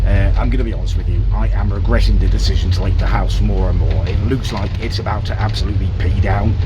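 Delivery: muffled behind a face mask; talking loudly through a mask on a bus. Real, not acted. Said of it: "hopefully you can hear me through my mask".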